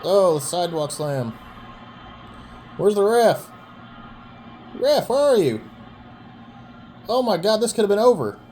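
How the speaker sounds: loud, high excited shouting. Real, not acted. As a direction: yell for the missing ref. Excited calling of a near finish.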